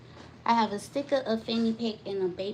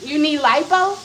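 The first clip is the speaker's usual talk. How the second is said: incredulous; 5 semitones higher, 11 dB louder than usual. Real, not acted. Incredulous question.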